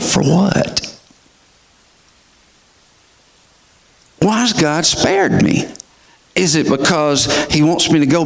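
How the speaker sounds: rising, pleading inner voice; a man asking God why he lived; anguished questioning. Retold or imitated. Searching questions to himself, pleading.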